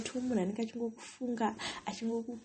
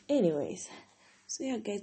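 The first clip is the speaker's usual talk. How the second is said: normal talking voice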